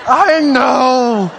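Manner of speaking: high, loud mock protest; comic exasperated wail. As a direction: whine it back at your wife, hammed up. Retold prideful reply to wife.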